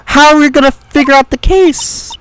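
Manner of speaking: high, drawn-out whine of complaint; exasperated protest. Acted. Protests being barred from lodging house.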